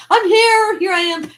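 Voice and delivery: high, loud re-enacted shout; playful, high-pitched holler; calling out. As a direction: call out from a hiding place. Hidden child calls out.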